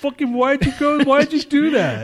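high-pitched indignant outburst; exasperated scolding. Real, not acted. Storyteller berating co-host for jumping ahead, exasperated. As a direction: demand an explanation, voice way up.

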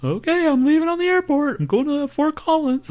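mocking the friend's constant texts, very high. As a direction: read his texts in a silly high voice. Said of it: "We got all these".

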